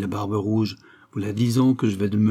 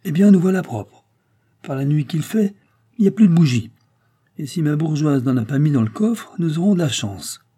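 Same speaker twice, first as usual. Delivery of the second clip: raised, high-pitched cry of annoyance; exclaiming; 5 semitones higher, 5 dB louder than usual. A narrator's reading. Driver finds no candle left in the lantern, exclaiming. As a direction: exclaim it louder and higher. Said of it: "et s'écria".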